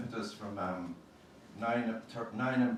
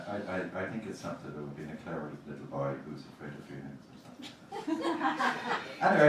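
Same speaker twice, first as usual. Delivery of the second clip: usual speaking voice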